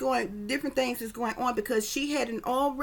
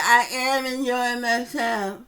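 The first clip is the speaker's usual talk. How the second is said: put-on slow, slurred, louder drunk voice; drunken slurring; 5 dB louder, much slower than usual. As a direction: drag each word out drunkenly. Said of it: "slurring, dragging her words".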